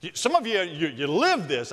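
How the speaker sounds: normal talking voice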